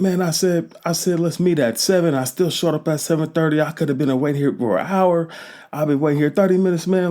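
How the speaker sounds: angry rant. Retold or imitated. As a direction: vent hard like you've been kept waiting. Ranting at late friend.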